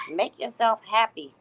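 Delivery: ordinary delivery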